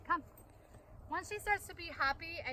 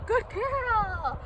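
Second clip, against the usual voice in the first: excited praise; 9 semitones higher, 9 dB louder than usual. Real, not acted. Owner praising her dog Honey with a high, loud, excited voice. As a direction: gush over her like a proud owner.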